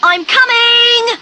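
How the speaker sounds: high, loud, drawn-out call; shouting. A narrator's reading. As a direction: call out urgently to someone you are rescuing. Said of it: "she shouts".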